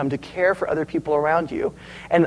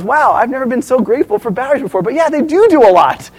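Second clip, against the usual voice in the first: higher, lively voice of an impressed mother; amazed mom; 7 semitones higher, 5 dB louder than usual. Retold or imitated. Mom marvels at her son's batteries, voiced with amazement. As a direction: act out a mom won over by enthusiasm.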